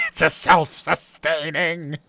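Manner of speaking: slow, gloating mad scientist voice. Pretend. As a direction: savor each word like a mad genius. The genius of my plan.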